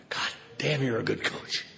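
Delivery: soft, sighing self-praise; quiet, contented murmur; satisfied sigh. Retold or imitated. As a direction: breathe it out, deeply relaxed. Relaxed self-praise after vodka.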